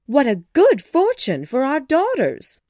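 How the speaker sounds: high, loud, thrilled wife; gushing, excited mother. A narrator's reading. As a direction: exclaim with great delight. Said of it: "cried Missus Bennet excitedly".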